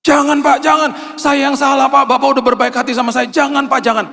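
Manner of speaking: put-on pleading young man. The man who hit his car refusing the money, high and pleading. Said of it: "Ditolaklah sama dia".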